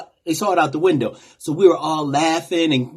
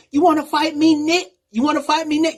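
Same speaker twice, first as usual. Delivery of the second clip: higher-pitched, challenging angry woman's voice; angry woman driver; 10 semitones higher than usual. Retold or imitated. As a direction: throw it at him like a dare, pitched up high. Angry driver confronts him.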